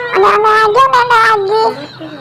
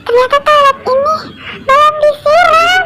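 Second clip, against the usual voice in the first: high-pitched, hammed-up distress; squealing mock cries for help; grossed-out panic; 6 semitones higher than usual. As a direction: wail it helplessly, playing up the disgust. Finds unflushed toilet, cries for help.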